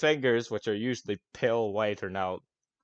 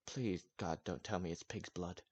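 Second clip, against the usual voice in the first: dreading mutter; 2 semitones lower, 12 dB quieter than usual. Acted. NPC dreads the answer.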